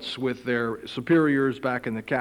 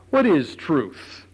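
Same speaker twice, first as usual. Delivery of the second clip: 1 weary Pilate; 4 semitones higher, 4 dB louder, slower than usual. Acted.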